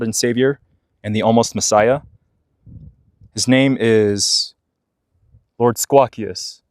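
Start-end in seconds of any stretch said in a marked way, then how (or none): 3.3-6.6 slow, grand reveal of a name; solemn proclamation. Pretend. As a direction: announce it slowly like a holy title. Solemnly unveiling the magpie lord's name.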